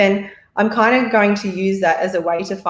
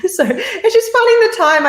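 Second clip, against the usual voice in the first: high, laughing through the words; 14 semitones higher, 5 dB louder than usual. Real, not acted. Guest admitting she is too busy, laughing helplessly. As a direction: let real laughter lift the voice.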